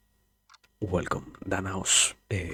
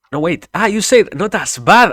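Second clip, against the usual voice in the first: much higher and louder, caught out; startled outcry; 12 semitones higher, 18 dB louder than usual. Real, not acted. Streamer realizing chat said the opposite, startled shout. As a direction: blurt it as you realize the mistake.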